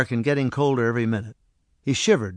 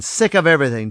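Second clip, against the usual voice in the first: loud, raised cry of despair; louder, higher outcry; 4 semitones higher, 6 dB louder, faster than usual. Acted. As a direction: burst out, fed up with everything. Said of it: "George cried".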